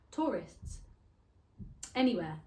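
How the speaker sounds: ordinary talk, nothing marked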